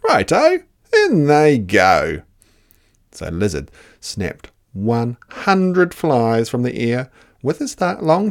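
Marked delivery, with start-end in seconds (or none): 0.1-2.2 high, loud, cheeky Dingo; a greedy dog egging the others on. Acted. Dingo cheers Platypus tipping mud in.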